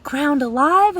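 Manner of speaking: loud, high-pitched incredulous question, acted out; mock disbelief. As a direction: imitate a shocked echo, high and loud. Printer's shocked echo, retold.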